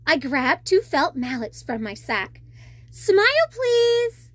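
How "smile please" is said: much higher and louder; bright instruction. Acted. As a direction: call it up brightly to the shark. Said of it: "I instructed".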